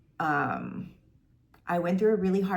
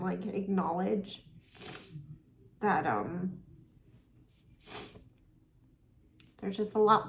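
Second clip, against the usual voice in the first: through tears; 3 semitones higher than usual. Real, not acted. Telling viewers about her long custody battle, voice tearful. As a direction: keep talking while trying not to cry. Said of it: "so I apologize for crying".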